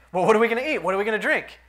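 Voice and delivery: mock panic over food and drink; mock worried crowd. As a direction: ham up their anxious grumbling. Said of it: "that's exactly what they were saying".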